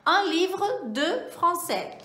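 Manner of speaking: slow careful French phrase for students; French model phrase. Shown as a demonstration. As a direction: lift "Un livre de français" a little. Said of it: "a gente viu o uso do de".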